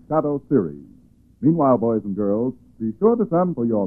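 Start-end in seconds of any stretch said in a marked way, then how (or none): none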